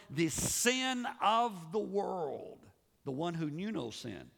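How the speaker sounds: normal talking voice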